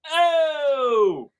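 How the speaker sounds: long, high shouted "Oh" that falls away at the end; stadium shout. Retold or imitated. Fan showing how the stadium shouts the huge O in the anthem, loud crowd yell. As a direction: belt it out like a whole crowd. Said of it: "the entire state stadium shouts".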